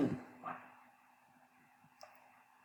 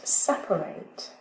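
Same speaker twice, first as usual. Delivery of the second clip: "separate" said slowly, stress on "sep-"; slow stress demo; 2 semitones lower, slower than usual. Shown as a demonstration. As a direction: model "separate", leaning on "sep-". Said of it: "teacher models word stress". ("separate" lentement, accent sur "sep-")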